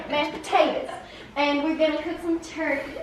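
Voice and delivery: Southern cooking-show patter; Southern accent. Shown as a demonstration. Child plays at a cooking show, Southern accent. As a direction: announce dinner in a Southern accent. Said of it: "we should do an Southern accent".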